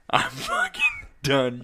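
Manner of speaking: breathless, wheezing laugh through the words; laughing whisper. Real, not acted. Laughing while declaring done.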